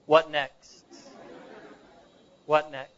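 low, quiet old pastor, lightly voiced. Old pastor pressing the boy about after death, low and quiet. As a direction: let the question land low, pause, repeat it. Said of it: "the old pastor said to him".

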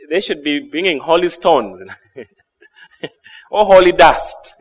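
joking, with a laugh breaking in; amused chuckling. Real, not acted. Preacher joking to congregation about holy souvenirs, chuckling. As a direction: chuckle through the joke.

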